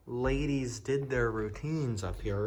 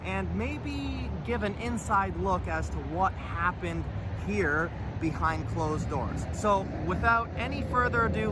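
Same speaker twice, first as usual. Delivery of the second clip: raised, upbeat presenter voice; vlog intro narration; 8 semitones higher than usual. Acted. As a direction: announce it like a show host.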